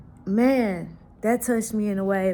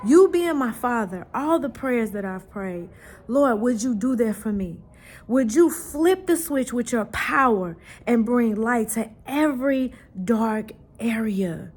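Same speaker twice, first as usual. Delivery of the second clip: pleading prayer rising higher and louder, ending in a whisper; fervent prayer; 4 semitones higher, 3 dB louder than usual. Retold or imitated. Mother retelling her own prayer to God, fervent rising plea ending in a whisper. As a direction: pray earnestly, building, then hush.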